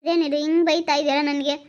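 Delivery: ordinary delivery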